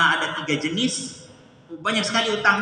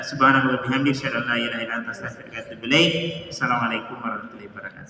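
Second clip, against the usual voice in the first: closing prayer; 8 semitones lower, faster than usual. Acted. (doa penutup, rendah dan lembut)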